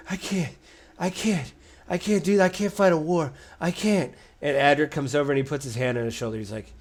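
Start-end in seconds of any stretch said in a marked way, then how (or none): 0.1-4.1 breathless, higher voice, near panic; a young inventor losing her nerve about the war, panicking; panicked hyperventilating. Acted. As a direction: gasp the words out as if she can't breathe. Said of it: "Tatina starts hyperventilating".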